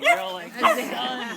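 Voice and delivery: high, loud mock audience voice; a hammed-up squinting crowd; imitating the audience.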